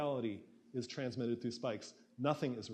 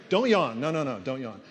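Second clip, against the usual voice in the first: playful warning; 8 dB louder than usual. Pretend. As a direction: tease them not to yawn. Teasing audience not to yawn.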